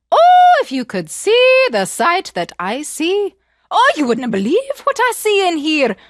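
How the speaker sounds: high, loud and slow, teasing wonder; excited cry. Acted. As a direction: lure her in with amazement. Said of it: "she cried out from inside the sack".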